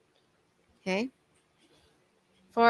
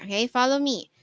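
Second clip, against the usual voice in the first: ordinary talk, nothing marked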